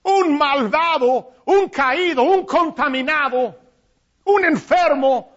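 preacher stretching the word out. Acted. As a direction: lean on each word. Listing the unworthy, slowly.

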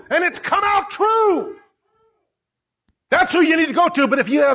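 rising, emphatic sermon voice; preacher pressing home the truth; preaching. Acted. Preacher builds to full force.

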